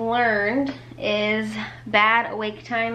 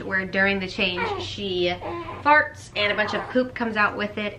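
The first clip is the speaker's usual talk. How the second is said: usual speaking voice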